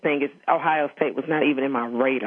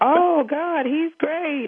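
much higher, slow mock-delighted father; gushing dad; 14 semitones higher, 3 dB louder, much slower than usual. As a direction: overdo the thrilled parent, drawn out. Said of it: "And it was like".

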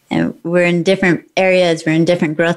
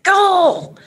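high, playful mock cheer sending them away; cheering the kid off; 11 semitones higher than usual. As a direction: shoo them out the door with a bright whoop. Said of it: "we'll be like".

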